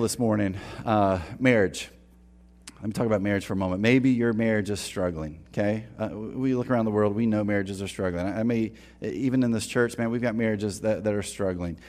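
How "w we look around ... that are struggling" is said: ordinary delivery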